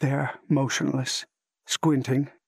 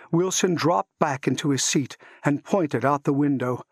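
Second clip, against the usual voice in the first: plain reading voice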